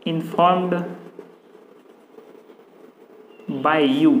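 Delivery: long pauses between single words; word-by-word dictation. Real, not acted. Teacher writing out the passive sentence for students, word-by-word dictation. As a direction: dictate it slowly, one word at a time.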